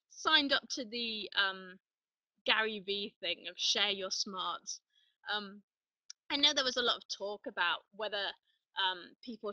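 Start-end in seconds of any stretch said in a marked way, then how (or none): none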